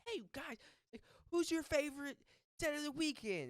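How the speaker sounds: high-pitched costumed character voice; in-character melon man. Retold or imitated. Costumed guy asking the friends a question, character voice. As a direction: put on an over-eager festival mascot voice. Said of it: "this is how he's talking, dude".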